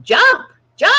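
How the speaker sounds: high, laughing mock yell at the screen. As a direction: shout jump while half laughing. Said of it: "yelling at it".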